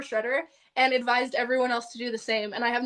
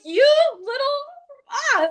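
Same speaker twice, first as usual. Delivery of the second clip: high, loud exasperated outburst; mad; 6 dB louder than usual. Retold or imitated. Retelling her anger at the man wanting a hug, exasperated. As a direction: sputter with fury, giving up on words. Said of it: "I was so mad".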